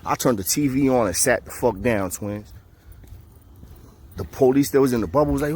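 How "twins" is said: ordinary delivery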